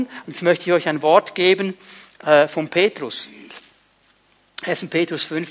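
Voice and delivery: ordinary talk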